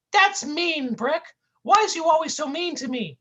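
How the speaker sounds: high goofy cartoon whine. Sidekick whining at his mean partner, high goofy voice. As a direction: plead like a hurt buffoon.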